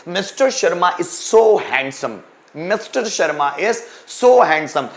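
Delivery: very slow, each word spaced; slow dictation. Shown as a demonstration. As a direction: read it out slowly for students to write. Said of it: "teacher dictates example sentence".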